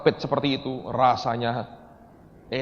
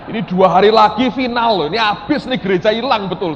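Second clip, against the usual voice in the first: loud, high cry of alarm to God; acted panicky protest at full volume; desperate shouted plea; 8 semitones higher, 14 dB louder than usual. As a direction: shout it like time has run out. Desperate complaint to God, re-enacted.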